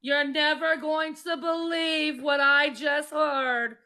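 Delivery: lightly done Italian mom gushing; playful Italian accent, asked for by someone. Pretend. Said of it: "do my Italian accent again".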